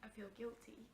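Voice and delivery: very soft, almost whispered; very quiet. Acted. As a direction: breathe it out barely heard. Barely audible confession.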